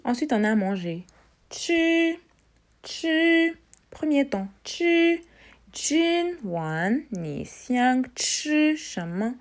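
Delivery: ordinary talk, nothing marked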